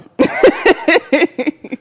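loud high laugh; a real laugh at herself; burst of laughter. Real, not acted. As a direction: laugh it off, tired and giddy. Laughing at own tired brain.

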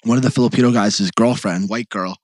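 ordinary delivery

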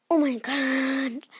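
slow, loud, stretched-out groan of words; exasperated dismay. Real, not acted. Vlogger appalled by the activated charcoal, exasperated. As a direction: drag it out loudly in dismay.

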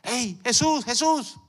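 high, loud call. Acted. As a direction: call after Jesus urgently. Entraron allá.

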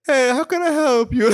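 a high waiter greeting customers, high-pitched and loud; loud, high-pitched mock greeting from someone stoned. Imagining greeting diners while high, hammed-up stoned waiter. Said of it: "if I was high and working".

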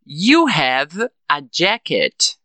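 "jacket" falling at the end, slow and measured; affirmative statement. Shown as a demonstration. Teacher models the affirmative for English learners, slow falling statement. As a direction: say it slowly as a statement, "jacket" dropping. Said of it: "Na afirmativa, eu digo".

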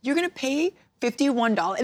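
high, disbelieving squeal of surprise; stunned disbelief. Retold or imitated. To the stranger paying for her pizza, high stunned disbelief. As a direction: gasp it out like you can't believe a stranger's kindness. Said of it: "I'm like".

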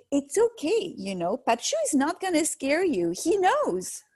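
put-on high little-child voice, calm and sure; toddler reassuring. Mother voicing her toddler telling her grandpa won't scare her, high child voice. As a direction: comfort mom like a knowing little kid. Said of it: "She's like".

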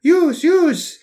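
high, mock-earnest classmate voice; light impression of a school friend; imitating the classmate. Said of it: "jag måste göra hans röst då".

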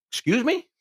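high, incredulous question; taken aback. Retold or imitated. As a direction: pitch jumps up in mock disbelief. Reacting to being called a pig.

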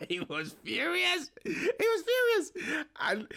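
high, drawn-out words through real laughter; laughing. Real, not acted. Telling of a stranger's broken jet wash, laughing. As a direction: barely get it out for laughing.

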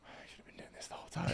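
breathless hushed whisper, fast. Retold or imitated. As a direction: whisper it to yourself quickly. Retold private realisation, whispered.

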